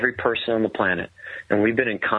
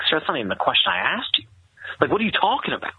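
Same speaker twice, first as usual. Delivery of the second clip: quick, baffled pushback, rising higher; retold incredulous objection; 5 semitones higher, faster than usual. Sergeant questioning his captain's answer, quick incredulous retort. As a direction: imitate a puzzled soldier challenging an answer.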